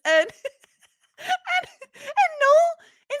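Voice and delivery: high, loud laughing restart; trying to go on through laughter; real laughter. Real, not acted. Still laughing at the scene.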